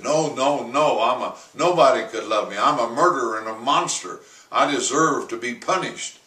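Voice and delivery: loud and fast despairing cry; frantic self-condemnation. Retold or imitated. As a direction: rush out the guilt in torment. George's repeated despairing refrain.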